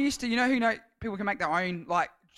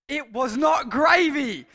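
shocked discovery; 6 semitones higher, 7 dB louder, slower than usual. Acted. Tasting salad dressing on knife.

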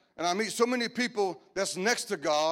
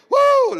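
loud, piercing "woo"; sudden exclaiming whoop; high whoop; 13 dB louder than usual. Real, not acted. Whoops while laughing at own point.

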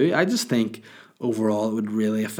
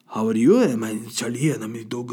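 talking dog in a put-on Chinese accent; mock Chinese accent. Dog introducing himself to owner, mock Chinese accent. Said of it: "This is the way I talk".

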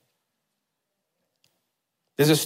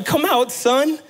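a higher, lifted cry of relief; 11 semitones higher, slower than usual. A narrator's reading. Father finding his son alive in the rubble, relieved. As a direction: let the joy break through. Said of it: "he said with relief".

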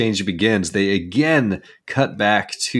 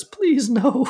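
high-pitched mock plea; imitated pitiful begging, pitched way up; pleading movie character. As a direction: squeak it out like a helpless stoner. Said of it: "poor guy number three says".

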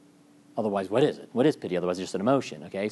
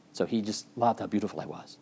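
lightly voiced vain charmer; a hint of a flattering heroine; mock Francesca; 2 semitones lower, 6 dB quieter than usual. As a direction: preen a little, mock her vanity. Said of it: "She's very charming".

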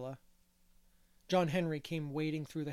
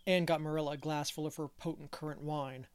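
usual speaking voice

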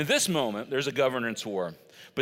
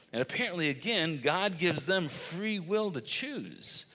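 normal talking voice

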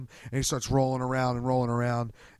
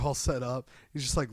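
ordinary delivery

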